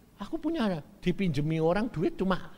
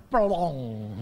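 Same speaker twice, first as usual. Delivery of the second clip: usual speaking voice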